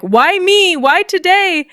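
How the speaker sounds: high, mock anguished cry; a hammed-up silent scream voiced high; inner scream. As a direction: wail it to the sky in mock despair. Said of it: "inside I'm screaming".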